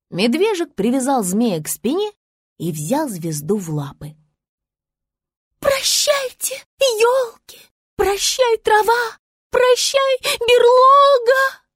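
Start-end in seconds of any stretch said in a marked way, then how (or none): 5.6-11.6 высоко и громко, протяжно, театрально; звёздочка драматично прощается; взвыла. A narrator's reading. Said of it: "Взвыла звезда". (Звезда воет прощание, медленно на берлоге)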